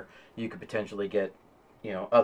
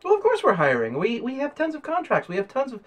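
high, eager receptionist voice; young lady receptionist; 12 semitones higher, 4 dB louder than usual. Acted. Receptionist tells job seeker they are hiring, high-voiced and welcoming. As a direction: stumble along in a high-pitched receptionist voice.

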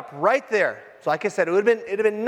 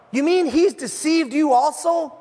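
slow shouted scoff, higher and louder; angry retort; 6 semitones higher, 4 dB louder than usual. Retold or imitated. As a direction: bark it back in disbelief. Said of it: "The Pharisees retorted".

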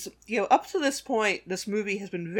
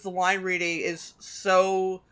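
ordinary talk

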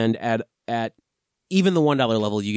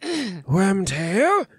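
Voldemort voice; 9 semitones higher than usual. Acted. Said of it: "The Voldemort voice".

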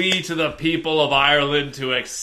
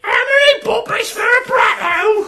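much higher, loud silly shout; shouted quiz; 7 dB louder than usual. Real, not acted. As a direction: yell the absurd question.

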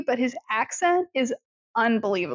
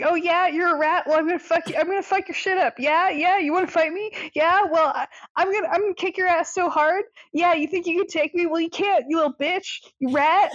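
higher-pitched, cocky threats; mock trash talk; 5 semitones higher, faster than usual. Ginger dead man threatening a rat, mock trash talk. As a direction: pick a fight, imitating a tough guy.

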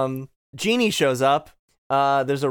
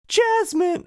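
missed impression in very high falsetto; squeaky, failed attempt at a hero's voice; Aladdin. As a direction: call the name way up high. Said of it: "in Aladdin voice goes".

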